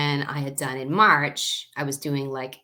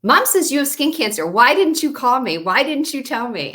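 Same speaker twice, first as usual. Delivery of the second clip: higher, louder anxious sister demanding answers; worried sister; 8 semitones higher, 6 dB louder than usual. Retold or imitated. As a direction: scold me like a hurt little sister. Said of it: "She's like".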